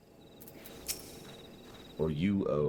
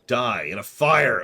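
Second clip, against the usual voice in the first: mock outburst; 4 semitones higher, 7 dB louder, slower than usual.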